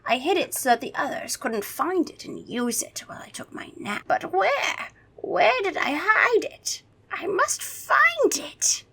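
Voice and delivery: high, whispery vampire desperation; theatrical whispered vampire worry; muttering vampire. A narrator's reading. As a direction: fret to yourself, slowing into urgency. Vampire muttering frantically to himself.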